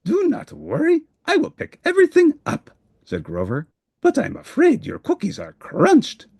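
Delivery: very high, bright helper voice; a helpful monster rushing to reassure; eager Grover. Acted. Grover helps Cookie Monster.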